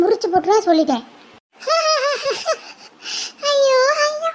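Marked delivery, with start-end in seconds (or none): 1.6-2.6 a high, teasing cackle at her sister; shrill jeering giggle at her sister's expense; mocking high laugh. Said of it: "இப்ப யாருன்னா உன்னை சிரிக்க சொன்னாங்களா?". 3.5-4.3 mock wailing. Sister mocks the scolded one.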